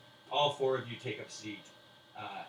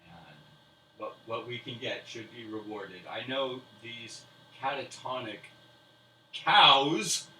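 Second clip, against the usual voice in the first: slow grand speech; 6 dB louder, slower than usual. Acted. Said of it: "And he says".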